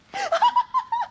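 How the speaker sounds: high theatrical giggle. Acted. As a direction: trill a giggle like a gossip reporter. Reporter laughs theatrically. (petit rire aigu théâtral)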